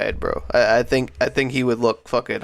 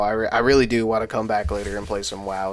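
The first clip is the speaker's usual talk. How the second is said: normal talking voice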